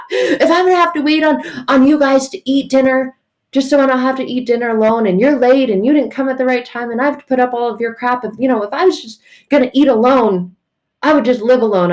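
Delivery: high, loud stepdad tirade; mock angry rant. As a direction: rant on, fed up, played for laughs.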